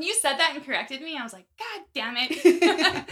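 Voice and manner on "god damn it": loud, high, drawn-out mock curse breaking into laughter; mock exasperation. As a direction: stretch it out as a playful outburst. Retells reaction to being corrected.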